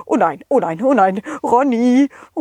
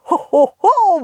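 Santa laugh; 8 semitones higher, 3 dB louder than usual. Acted.

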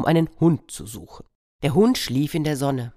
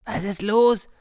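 hard-of-hearing farmer; 7 semitones higher than usual. Acted. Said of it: "fragte der Bauer".

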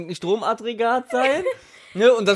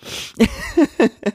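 high giggling laugh; high burst of laughter; 13 semitones higher than usual. Real, not acted. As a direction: break into laughter. Laughs at own joke.

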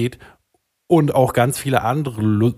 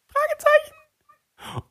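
unsure question; much slower than usual. Acted. Host unsure of episode number.

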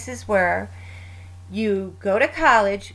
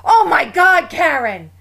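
higher and louder, an imitated exasperated sister; mock exasperated outburst; 7 semitones higher, 8 dB louder than usual. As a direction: squeal it like a sister begging not to walk. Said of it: "She'll be like".

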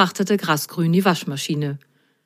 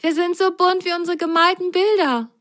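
much higher, thrilled; delighted Rosenrot; 11 semitones higher than usual. Acted. Rosenrot exclaims at their spots, high and thrilled. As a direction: marvel at the colours. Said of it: "rief Rosenrot".

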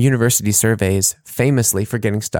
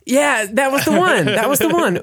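delighted recognition; 13 semitones higher than usual. Real, not acted. Delighted at remembered joke.

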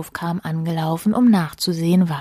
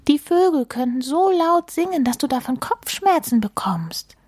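much higher-pitched, fussy warning; exclaimed warning; 10 semitones higher than usual. Acted. Mole warning mouse about birdsong, high exclaimed warning. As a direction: voice climbs high in mock alarm. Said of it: "rief Maulwurf".

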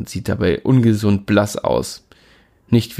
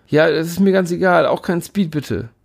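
prim and uptight; 8 semitones higher than usual. A narrator's reading. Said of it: "klinge ich wie der spießigste Mensch der Welt".

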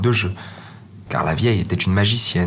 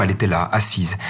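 ordinary delivery

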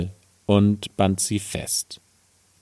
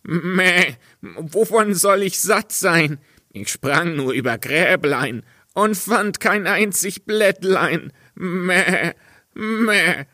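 high, bleating goat voice, drawing out the Meh; 10 semitones higher than usual. Acted. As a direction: play the cheeky goat bleating back. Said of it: "Und die Ziege antwortete". (hohe, meckernde Ziegenstimme)

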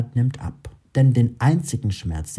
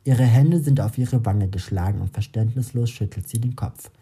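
ordinary delivery, nothing marked

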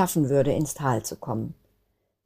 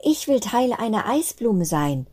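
higher, lightly voiced small child; wishing child; 7 semitones higher than usual. Flöckchen wishing aloud to her siblings, lightly voiced child. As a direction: wish it dreamily. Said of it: "wünschte sich Flöckchen".

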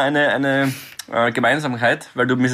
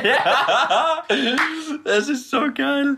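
laughing; 12 semitones higher, much slower than usual. Real, not acted. Laughing at recited movie jokes.

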